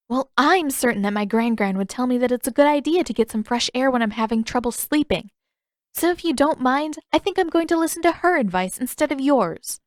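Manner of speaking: high, pointed, cheeky defiance; defiant sass. Acted. Katara defying Pakku to make him leave. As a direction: throw his advice back at him.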